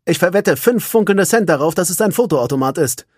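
hard-nosed sergeant making a bet; tough sergeant. A narrator's reading. Sergeant Kalun bets her husband, tough and loud. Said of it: "tough wie immer".

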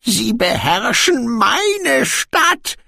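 high, drawn-out groan of a dwarf king. A narrator's reading. Dwarf king laments his occupied city, groaning. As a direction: groan it out slowly and high-pitched. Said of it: "ächzte Brokando".

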